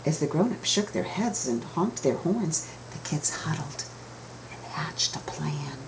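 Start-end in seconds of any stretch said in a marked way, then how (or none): 2.9-5.8 whispered, conspiratorial telling; hushed narration. A narrator's reading. Narrator telling of kids plotting, in a whisper. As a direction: breathe it like a secret plan.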